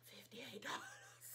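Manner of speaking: slow, shocked whisper; whispered price. Real, not acted. Reading the serum's price to viewers, slow stunned whisper.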